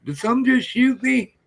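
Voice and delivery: high-pitched, puzzled retold question; mock bewilderment. Acting out a startled shot animal.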